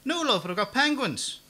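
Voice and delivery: put-on high, loud old lady calling from the kitchen; old woman shouting an offer from the kitchen, high and loud; shrill old woman. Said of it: "The old woman's voice shrilled".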